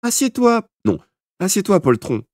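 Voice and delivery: high, forceful mock-bossy shout; shouted order. A narrator's reading. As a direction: bark it at the coward. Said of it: "lui cria Thomas".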